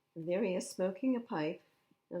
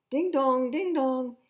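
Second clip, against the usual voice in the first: high, loud clanging bell sound; playful siren noise for kids; fire bell imitation; 8 semitones higher, 12 dB louder, slower than usual.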